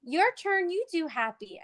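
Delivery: animated happy demo. Shown as a demonstration. Therapist modelling a happy face for a toddler, animated. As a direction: model happiness for the child. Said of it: "my examples".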